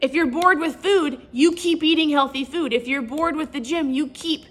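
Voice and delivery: high, loud heated argument; retelling arguing with her husband, heated; heated retort. Retold or imitated. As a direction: argue back hard, voice up. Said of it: "And I said".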